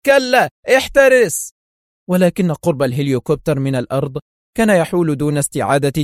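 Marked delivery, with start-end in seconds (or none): 0.1-1.5 high, loud, drawn-out shout; slow, high alarmed cry; screamed warning. A narrator's reading. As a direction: yell a warning, stretched out. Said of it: "وصرخ القناصان داخلها".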